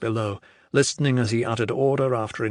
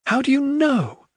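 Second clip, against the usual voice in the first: high, louder insistent demand; 14 semitones higher, 4 dB louder than usual. A narrator's reading. Adair demanding an answer from the general, insistent. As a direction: press him hard for an answer. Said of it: "Adair demanded".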